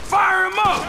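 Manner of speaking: loud, high battle yell; shouted order. Acted. Gangster yelling at his crew mid-shootout, loud order. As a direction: bark the order over gunfire.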